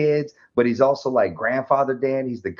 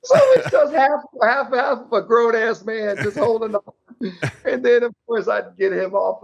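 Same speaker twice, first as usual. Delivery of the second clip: much higher, louder, wheezing laughter; can barely get the words out for laughing; laughing hard; 9 semitones higher, 4 dB louder than usual. Real, not acted. Telling dark story, laughing helplessly.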